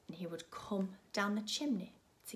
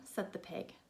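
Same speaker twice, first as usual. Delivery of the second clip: ordinary delivery, nothing marked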